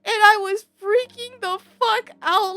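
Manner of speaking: very high, loud, laughing squeal; helpless laughter. Real, not acted. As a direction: squeal the story out through laughter. Laughing hard at holy water story.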